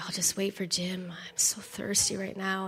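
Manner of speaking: unvoiced, tired murmur; weak whisper. Weak wife lying on the bed, whispering. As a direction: barely breathe the words.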